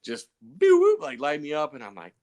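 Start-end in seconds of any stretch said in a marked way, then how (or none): 0.4-1.0 quick high siren whoop; loud, high-pitched mimicked cop-car blip; imitated police siren.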